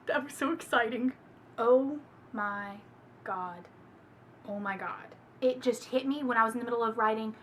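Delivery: ordinary delivery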